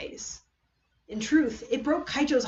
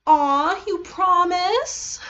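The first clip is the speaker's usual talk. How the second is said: mock delight; 9 semitones higher, 8 dB louder, much slower than usual. Kokichi goads Maki.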